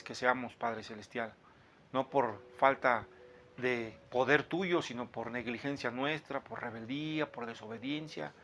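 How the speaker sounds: hushed, earnest prayer voice; quiet devout prayer. Acted. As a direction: pray softly and humbly before God. Opening prayer before teaching.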